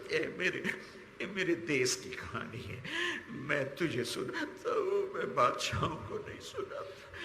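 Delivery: halting words swallowed by sobs; choked weeping. Real, not acted. Preacher tells God his country's story, choked with tears. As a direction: let tears break up the words.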